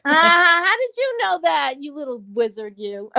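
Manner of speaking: loud, high laughing mock accusation, then dropping; playful high squeal of a question; laughing tease. Real, not acted. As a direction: tease through laughter, falling away at the end. Teasing co-host who caught her.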